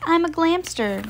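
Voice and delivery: high-pitched proud reveal; proud announcement. A narrator's reading. Harriet reveals her new look to Patricia, high proud announcement. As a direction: announce the new identity with a flourish. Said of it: "Harriet announced".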